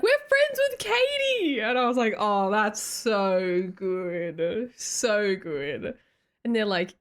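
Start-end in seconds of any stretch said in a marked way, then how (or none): 0.0-1.6 high voice. Retold or imitated. Voicing the strangers in the story. 2.2-6.0 slow. Retold or imitated. Quoting her own reply.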